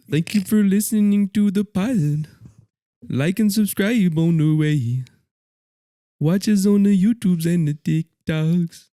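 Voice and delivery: high, loud put-on rapper voice. Telling podcast listeners goodbye as an imitated rapper, high and loud. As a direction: belt out the sign-off high in an exaggerated impression.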